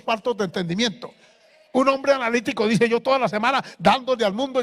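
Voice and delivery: loud, driving pulpit preaching; sermon at full force; fiery preaching. Acted. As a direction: rail at the congregation from the pulpit.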